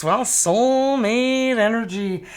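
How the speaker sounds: normal talking voice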